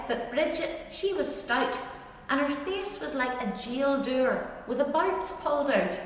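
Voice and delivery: usual speaking voice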